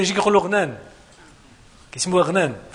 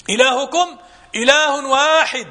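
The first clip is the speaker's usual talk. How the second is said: slow Quranic recitation; 4 semitones higher, much slower than usual. Acted. Preacher reciting the verse to his audience, slow and solemn. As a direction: intone the verse slowly and solemnly. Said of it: "ثم قال".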